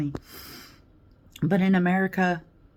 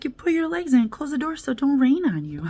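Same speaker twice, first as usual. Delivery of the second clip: much higher, coaxing cop voice; sweetly tells her to tuck legs in; imitating the cop; 7 semitones higher than usual. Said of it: "He's like".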